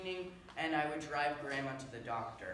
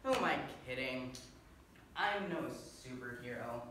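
low, sinking admission; deflated; 4 semitones lower than usual. Acted. Failed superhero admitting the truth to himself, deflated. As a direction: let the bravado drain away. Drops the hero act, gives up.

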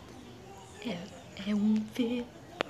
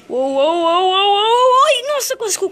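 rising, louder uou as he loses balance; startled cries of a dinosaur almost tumbling; wobbling alarm; 8 semitones higher, 8 dB louder than usual. Acted. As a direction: let it climb as you nearly fall. Almost falls hopping on one leg.

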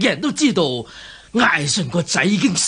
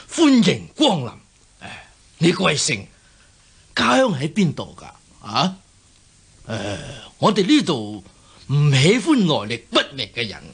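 ordinary talk

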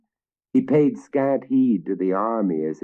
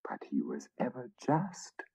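soft whispered protest; whispered objection; 6 semitones higher, 12 dB quieter than usual. A narrator's reading. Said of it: "objected the fifth knight".

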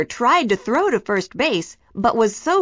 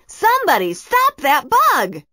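higher, alarmed crowd yell; shouting; 9 semitones higher, 4 dB louder than usual. A narrator's reading. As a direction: cry out as if from the stands. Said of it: "The parents were all shouting".

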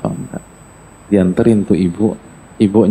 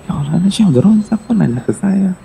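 shocked inner voice; 8 semitones higher than usual. Acted. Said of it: "Oh, shock gitu".

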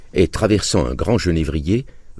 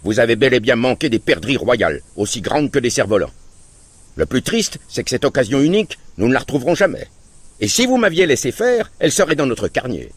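cutting tone; 8 semitones higher than usual. Acted. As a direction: hammer home the lost chance. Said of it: "d'un ton tranchant".

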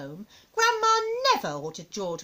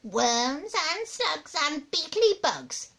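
high, very slow gloating; high granny savouring horrors; relishing old grandma; 5 semitones higher, much slower than usual. Acted. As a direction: draw out each creepy morsel. Grandma lists disgusting delicacies.

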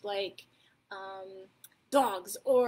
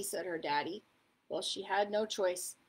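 plain reading voice

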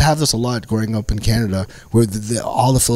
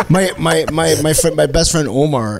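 ordinary talk, nothing marked

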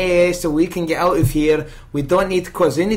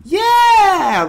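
excited; 14 semitones higher, 5 dB louder than usual. Acted. Excited reply to trade.

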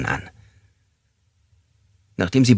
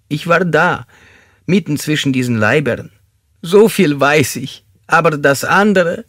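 high, confused confession; tormented fanatic suspect; 8 semitones higher, 4 dB louder than usual. Acted. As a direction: insist in a raised voice, then falter in doubt.

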